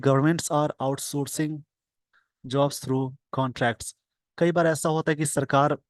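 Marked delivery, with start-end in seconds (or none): 0.0-3.9 each word drawn out slowly for the class; very slow dictation. Shown as a demonstration. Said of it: "slow dictation reading".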